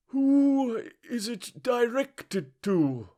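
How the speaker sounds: very high, slow little juryman voice. Acted. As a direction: ask it timidly and slowly. Juryman asks.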